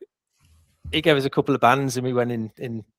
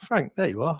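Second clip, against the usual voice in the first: mock cool; 6 semitones higher than usual. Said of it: "I played it cool".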